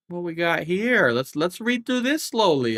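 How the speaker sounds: high, loud, drawn-out mock interest; exaggerated slow reading. Player pretending to read for viewers, exaggeratedly slow. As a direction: stretch it out with playful show.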